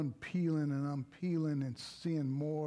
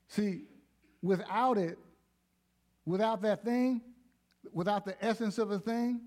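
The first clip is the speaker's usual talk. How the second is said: preaching at full force; 5 semitones higher, 4 dB louder than usual. Acted. Preaching builds.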